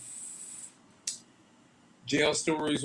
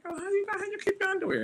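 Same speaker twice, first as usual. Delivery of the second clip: much higher, squeaky disbelief; incredulous question; 4 dB quieter, much faster than usual. Retold or imitated. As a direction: ask it like you can't believe it.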